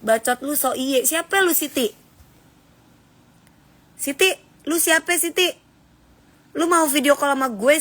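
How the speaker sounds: high-pitched, confrontational scolding; scolding challenge. Real, not acted. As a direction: call out a rude commenter, demanding answers. Confronts a rude commenter, challenges them. (omelan menantang dengan nada tinggi)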